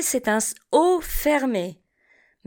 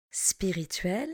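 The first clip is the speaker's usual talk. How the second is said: Spirituel repeated much softer and lower; 4 semitones lower, 10 dB quieter than usual. Shown as a demonstration. Teacher echoes Spirituel quietly for the class. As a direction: softly echo Spirituel. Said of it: "Répétez après moi".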